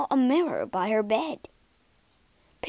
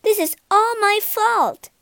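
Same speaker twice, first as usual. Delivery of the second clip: high, louder outburst of self-blame; distressed cry; 11 semitones higher, 5 dB louder than usual. A narrator's reading. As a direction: cry it out in dismay. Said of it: "cried Beauty".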